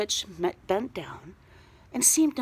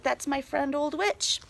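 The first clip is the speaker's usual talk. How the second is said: ordinary delivery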